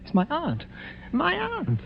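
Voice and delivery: gasping high with dread; the name catches in a terrified throat; shocked whisper of recognition; horrified. Acted. Guest sees dead aunt's ghost.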